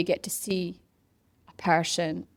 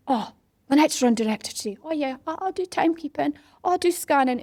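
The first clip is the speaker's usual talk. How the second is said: high-pitched, keen chiming in; eager volunteers; 8 semitones higher, 4 dB louder than usual. Retold or imitated. Volunteers signing up to help the run director, eager and high. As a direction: offer your help brightly, pitched well up.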